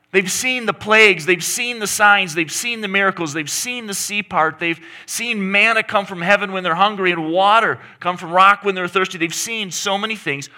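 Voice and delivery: high, loud listing of wonders; full-force preaching. Real, not acted. Preacher listing God's wonders to the congregation, preaching hard. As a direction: build the list like a sermon.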